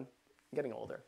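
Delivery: ordinary talk, nothing marked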